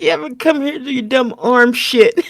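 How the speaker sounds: high, laughing through the quoted lines; barely holding it together while quoting; laughing quote. Retold or imitated.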